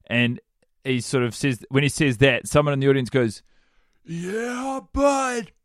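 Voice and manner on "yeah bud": Nick Swardson heckle. Retold or imitated. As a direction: imitate a distant heckler in a thin high voice. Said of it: "it's like an unmistakable voice".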